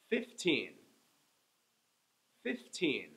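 put-on Fifteen with the teen stressed; fif-TEEN, second syllable strong. Shown as a demonstration. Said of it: "I'm saying each one twice".

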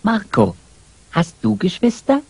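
usual speaking voice

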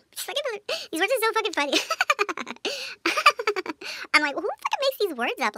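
can't stop laughing. Real, not acted. Amused by silly Spanish phrases, laughing high.

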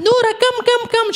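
high coaxing call to a dog. Retold or imitated. Calling the dog into car.

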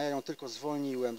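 normal talking voice

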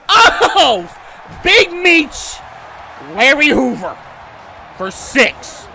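loud, high exclamations; real excited shout. Real, not acted. Gamer reacting to a big play, excited shout. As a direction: burst out in short, high shouts.

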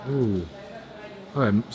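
usual speaking voice

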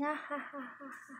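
high burst of laughter that fades out; cackling laugh. Retold or imitated. Comic laughing alone at her own creepy joke, high laughter. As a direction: crack up at your own joke. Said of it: "I start dying laughing".

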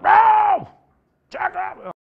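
huge, high-pitched bellow from the sideline; foghorn shout. Retold or imitated. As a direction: roar the check like a foghorn. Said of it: "has a voice like a damn foghorn".

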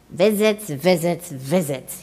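mildly grumbled complaint, dropping down; grumbling emperor. A narrator's reading. Grumpy emperor to himself, lightly grumbling. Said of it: "he grumbled".

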